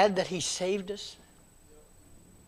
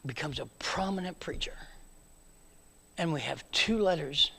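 soft breathy whisper; 7 dB quieter than usual. Acted. Preacher telling of Peter's later life, whispered. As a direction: let it fall to a whisper.

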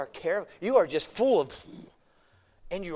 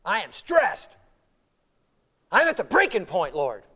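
stressed out; 2 semitones lower, 5 dB louder, slower than usual. Retold or imitated. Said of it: "Martha's words would be".

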